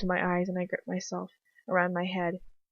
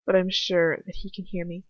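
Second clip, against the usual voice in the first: plain reading voice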